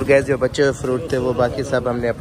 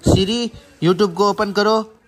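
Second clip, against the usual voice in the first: higher, slow, drawn-out command; raised, deliberate command voice; voice-assistant command; 6 semitones higher, 4 dB louder, much slower than usual. Real, not acted. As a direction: call it out slowly for a machine to catch.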